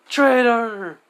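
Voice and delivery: high, loud cry of accusation; hammed-up outraged yell; mock accusing shout. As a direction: call it out loud and high, drawn out. Character's accusing cry retold.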